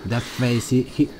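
usual speaking voice

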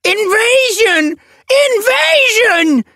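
very high, loud, drawn-out alarm cry; panicked shriek with stretched vowels; panic-stricken yowl. A narrator's reading. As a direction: screech a warning to the whole camp. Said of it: "the panic-stricken Yowl".

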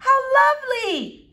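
high, loud, delighted gush; effusive, high-pitched mother. Acted. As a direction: coo it like a charmed mother. Mother gushes at boy's manners.

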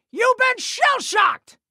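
high, slow triumphant shout; triumphant catchphrase. Acted. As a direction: shout it high, drawing out the words. Game hero's victory catchphrase.

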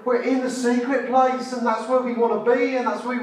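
high, loud, driving preacher's cry; building preaching fervour. Acted. Preacher building to a peak for the congregation, loud and high.

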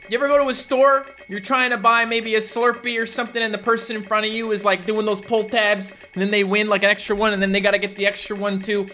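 the game character griping about the line at the store; avatar voice ranting. Acted. Avatar rants about pull tabs.

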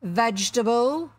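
loud captain's probing question; quizzing captain. A narrator's reading. Captain plays guessing game with stranger, probing. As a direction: press the question with booming weight. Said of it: "asked Hook".

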